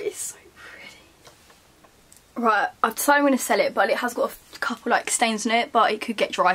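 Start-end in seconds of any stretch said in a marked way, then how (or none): 0.0-1.0 soft whisper, much quieter; whispered admiration. Real, not acted. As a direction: hush it lovingly. Admiring the item softly.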